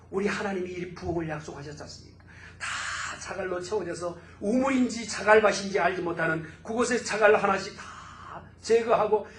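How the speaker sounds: normal talking voice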